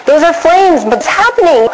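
high, panicked cry of disbelief; freaking out. Retold or imitated. Mother seeing flames where her kids are, panicked. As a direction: sound terrified for her kids. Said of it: "I'm freaking out, terrified".

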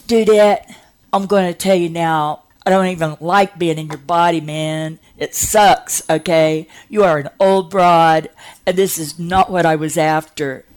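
acted teenage rant; complaining teenager. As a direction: gripe at her like an indignant teen. Acted complaining kid in story.

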